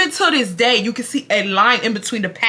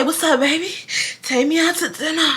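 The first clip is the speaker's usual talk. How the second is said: higher-pitched teasing greeting; sassy imitation of flirting; mock sweet talk; 5 semitones higher, 3 dB quieter than usual. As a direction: put on a playful come-on. Mock greeting she wanted.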